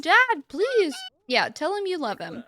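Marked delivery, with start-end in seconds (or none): 0.0-1.0 put-on high, louder pleading child; a frightened kid begging dad; child begging her dad for help; scared little girl. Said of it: "She's like".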